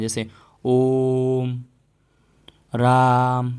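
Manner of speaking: ओम and राम held three times as long; teacher demonstrating a protracted vowel; drawn-out long vowel. Shown as a demonstration. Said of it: "समय तीन गुना समय लग रहा है".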